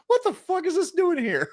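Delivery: high, surprised outburst; shocked. Retold or imitated. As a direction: blurt it out in disbelief. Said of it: "I'm like".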